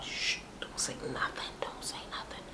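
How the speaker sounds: urgent secretive whisper; barely audible acted-out shushing; hushing whisper. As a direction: whisper it so the instructor can't hear. Acts out hushing friend before instructor.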